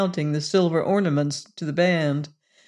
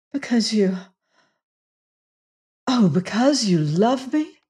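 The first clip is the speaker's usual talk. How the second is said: higher, trembling with disbelief; overwhelmed wonder; 4 semitones higher than usual. A narrator's reading. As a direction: ask it as if you can hardly believe it. Jane overwhelmed by Lassiter's confession.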